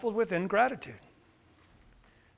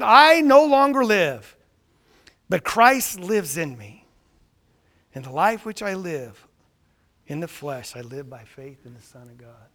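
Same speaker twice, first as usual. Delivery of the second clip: solemn quote; 6 semitones lower, 8 dB louder than usual. Retold or imitated. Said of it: "He said".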